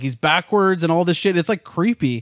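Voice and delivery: ordinary delivery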